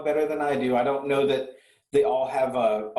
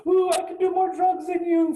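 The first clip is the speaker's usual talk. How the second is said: high, loud mocking squeal; shrill-free sneer at a bragging rocker; mocking boast; 4 dB louder than usual.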